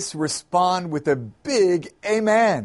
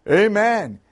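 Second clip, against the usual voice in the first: raised, hearty congregational shout; crowd's shout; 4 semitones higher, 4 dB louder than usual. A narrator's reading. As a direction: shout back like an excited congregation. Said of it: "The crowd shouted".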